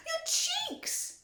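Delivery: mother screaming at Violet; screamed. A narrator's reading. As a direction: scream in panic. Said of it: "screamed Missus Beauregard".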